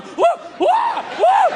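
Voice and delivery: high squeaky frightened whoas; shrill-sounding mock whimper. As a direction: imitate the man whining in panic. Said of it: "male going".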